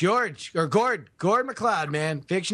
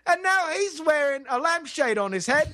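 high-pitched, excited British storytelling; comic British eyewitness, pitched up; mock British witness; 7 semitones higher than usual. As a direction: babble the tale in a hammed-up British voice.

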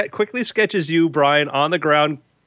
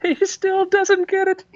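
high, tittering snobbish voice; giggling emperor; 13 semitones higher than usual. Acted. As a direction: giggle through it.

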